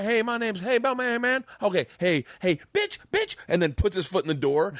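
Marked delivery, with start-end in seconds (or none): 0.0-3.4 put-on kid salesman losing patience. Retelling Mike Epps' chocolate bar bit, patience snapping. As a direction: pitch rising into a high yell. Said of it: "And he's like".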